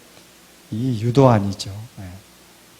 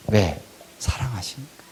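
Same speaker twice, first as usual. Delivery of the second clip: softer, whispered reply; whispered answer; 6 semitones lower, 10 dB quieter than usual. Real, not acted. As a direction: drop to a hush for the answer. Hushed answer to own question.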